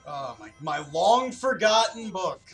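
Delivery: ordinary talk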